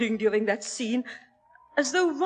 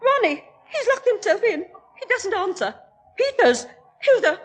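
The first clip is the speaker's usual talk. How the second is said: rising panic, higher and louder; frightened calling; 8 semitones higher, 3 dB louder than usual. Acted. Mother calling her son through a locked door, rising panic. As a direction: call through the locked door in growing alarm. I, I'm afraid.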